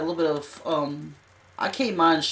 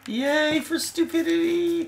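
mock cheer; 10 semitones higher, 3 dB louder, slower than usual. Mock cheer at stupid plan.

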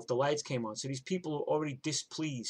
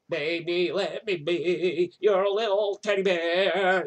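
slow, high crooning [name] imitation, louder; bad [name] impression; 5 semitones higher, 9 dB louder, slower than usual. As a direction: croon it like a lousy [name] impersonator. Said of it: "he didn't sound like [name]".

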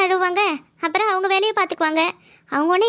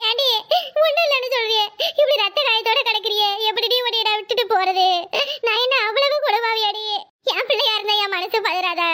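put-on lightly raised, worried elderly aunt voice; fussing village aunt; 4 semitones higher than usual. Aunt fussing over her bleeding niece, lightly raised worried voice. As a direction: scold the niece with an aunt's worry.

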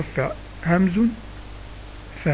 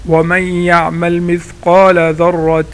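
ترتيل؛ تلاوة بطيئة أعلى صوتًا؛ رتّلها ببطء كقارئ؛ تلاوة مجودة; 5 dB louder, much slower than usual. Shown as a demonstration. تلاوة الآية.

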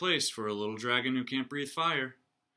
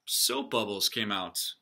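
ordinary delivery

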